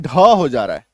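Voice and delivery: low, breathy "ढ" demonstrated; sound demo. Shown as a demonstration. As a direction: say "ढ" deep and aspirated. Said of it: "इसका उच्चारण क्या हो जा रहा है".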